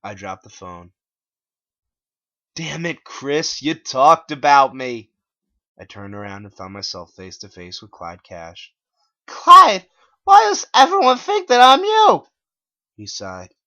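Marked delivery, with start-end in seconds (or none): 2.5-5.1 higher, louder, slow annoyed voice; irritated Clyde. Acted. Clyde appears, complaining. 9.3-12.3 very high, loud whine; shrill bewildered plea; distressed whine. Acted. Narrator distressed to Clyde.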